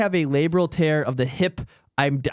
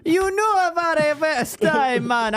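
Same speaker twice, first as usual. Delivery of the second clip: bad Jamaican accent; 11 semitones higher, slower than usual. Host admitting he can't do it, bad Jamaican accent. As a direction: fake Jamaican accent, starting high and slow, dropping at the end. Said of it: "I can't do the Jamaican accent".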